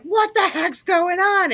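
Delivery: high, slow, baffled what the heck; baffled exclamation. Retold or imitated. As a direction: sound totally thrown by the noise. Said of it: "and I was like".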